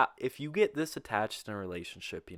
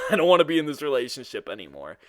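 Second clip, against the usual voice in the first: laughing; 4 semitones higher, 9 dB louder, faster than usual. Real, not acted. Retelling breakup line laughing.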